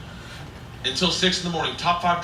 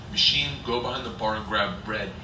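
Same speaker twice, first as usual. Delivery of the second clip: low, softer command; imitated gangster, pitched down; Russian tough guy; 6 semitones lower, 5 dB quieter than usual. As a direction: give a low-voiced order as the mobster. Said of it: "in Russian he says".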